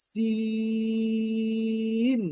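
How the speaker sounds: সিন held high on a very long ee; long drawn-out. Shown as a demonstration. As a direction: chant সিন slowly on one note before closing on n. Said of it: "দীর্ঘ টান কেন দিলাম?". (দীর্ঘ টান দিয়ে সিন)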